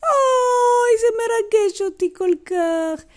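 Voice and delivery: very high, wailing "oy"; deeply moved grandma. Acted. Grandma seeing grandpa Haim's watch, overcome wail. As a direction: wail it tearfully, hammed-up.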